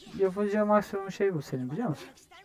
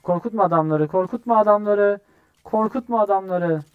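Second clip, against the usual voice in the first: loud, higher insistent repeats; urgent calling out; 5 semitones higher, 7 dB louder than usual. Real, not acted. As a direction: call a warning across to a teammate. Calls to teammate mid-chase. (yüksek sesle ısrarla uyarma)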